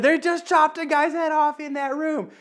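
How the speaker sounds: a lightly voiced frightened child; scared kid. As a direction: repeat the blurt as a young boy. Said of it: "the worst way I could have said that".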